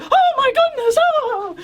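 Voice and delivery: high, breathless fainting lady; old-movie swoon. Retold or imitated. Old movie woman about to faint, high swooning cry. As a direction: play the swooning movie damsel. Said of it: "in old movies and stuff".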